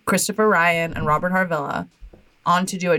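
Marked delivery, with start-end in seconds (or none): none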